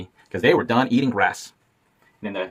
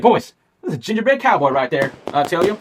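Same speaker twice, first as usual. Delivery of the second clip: higher, louder cowboy call; excited cowboy; 6 semitones higher, 5 dB louder than usual. A narrator's reading. Cowboy telling the boys he sees the cookie, loud. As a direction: point him out with a twang.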